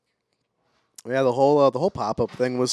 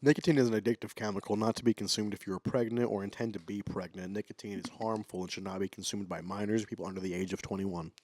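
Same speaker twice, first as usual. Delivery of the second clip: soft, quick legal warning read; ad disclaimer read; 3 semitones lower, 8 dB quieter than usual. Acted. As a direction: rattle off the fine print. Reads nicotine warning disclaimer.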